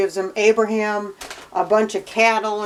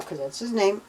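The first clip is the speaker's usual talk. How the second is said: usual speaking voice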